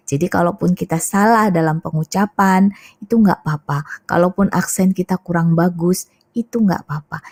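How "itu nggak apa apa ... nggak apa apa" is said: ordinary delivery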